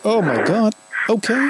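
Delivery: high, astonished gasp of disbelief; stunned, pitch jumping up; shocked surprise. Real, not acted. Host shocked by caller's news.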